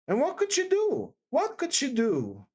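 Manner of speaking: high, insistent protest; worked-up, emphatic persistence; hot and excited. A narrator's reading. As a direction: press the question, starting high. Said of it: "she persisted, getting hot and excited".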